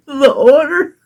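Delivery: usual speaking voice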